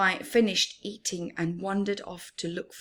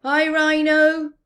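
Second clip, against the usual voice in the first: cheery greeting; 8 semitones higher, 10 dB louder than usual. Acted. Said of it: "greeted Nu".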